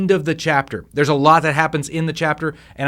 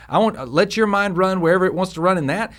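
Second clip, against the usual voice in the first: ordinary talk, nothing marked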